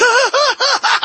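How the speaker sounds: very high shrieking mock laugh; mock cackle. Host parodying Harris's laugh, shrieking cackle. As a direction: cackle way up high to ridicule her. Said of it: "She's like".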